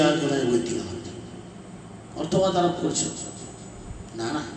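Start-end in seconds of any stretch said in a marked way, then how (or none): none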